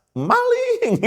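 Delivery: very high, mock cry of alarm; playful high-pitched imitation of a shout; imitated yell. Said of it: "dia teriak".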